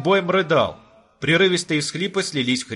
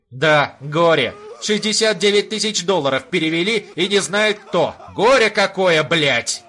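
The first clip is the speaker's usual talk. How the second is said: loud bitter sneering; angry sneer; 5 semitones higher, 4 dB louder than usual. Acted. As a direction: spit it out with angry scorn. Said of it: "Зло усмехнулся Виноградов".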